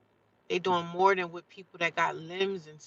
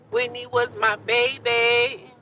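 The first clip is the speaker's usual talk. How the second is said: loud, high, drawn-out declaration; raised voice, lingering on every word; emphatic cry; 5 semitones higher, 8 dB louder, much slower than usual. Real, not acted. Repeats it loudly, drawn out.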